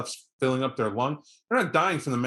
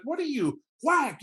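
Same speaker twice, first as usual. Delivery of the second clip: high, sudden mock strike; sharp high outburst; 12 semitones higher than usual. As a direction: snap it out like a blow. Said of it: "You're like".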